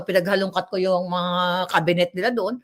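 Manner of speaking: ordinary talk, nothing marked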